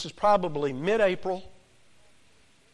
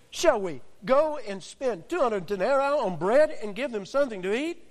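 higher, sarcastic disciple voice; smart-aleck retort; 5 semitones higher than usual. Retold or imitated. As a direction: sneer it back as a smart aleck. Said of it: "this is where they become smart alecks".

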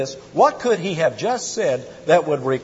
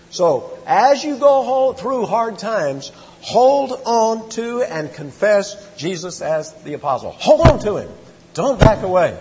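rising, louder pleading to hold on; impassioned preacher's plea, louder; urgent exhortation; 6 semitones higher, 4 dB louder than usual. Real, not acted. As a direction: urge them not to let go. Preacher urging congregation to hold on.

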